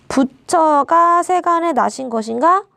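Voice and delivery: put-on reading an old text aloud. Shown as a demonstration. Reading a Middle Korean sentence.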